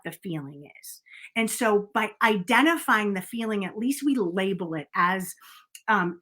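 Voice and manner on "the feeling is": breathy whispered aside; soft confiding whisper. Real, not acted. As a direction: lean in and whisper it to them. Coach confiding to listeners.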